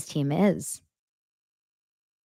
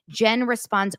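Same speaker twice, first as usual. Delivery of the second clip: ordinary talk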